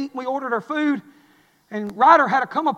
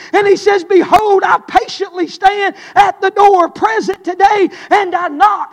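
loud, high, shouted preaching; preaching at full force; 5 semitones higher, 7 dB louder than usual. Acted. Preacher to his congregation, shouting the sermon. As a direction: thunder it from the pulpit.

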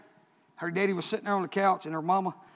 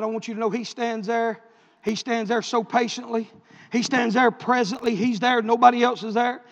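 ordinary delivery

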